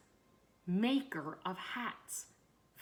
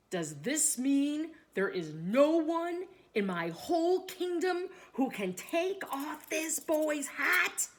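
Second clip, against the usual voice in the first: bellowing king; 6 semitones higher, 5 dB louder than usual. A narrator's reading. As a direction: bellow it as a king at the end of his patience. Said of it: "bellowed the king in a terrifying".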